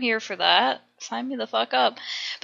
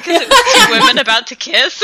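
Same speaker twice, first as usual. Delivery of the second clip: ordinary delivery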